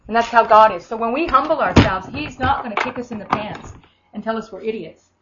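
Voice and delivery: usual speaking voice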